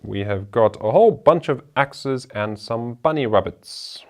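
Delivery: normal talking voice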